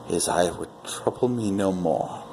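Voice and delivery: plain reading voice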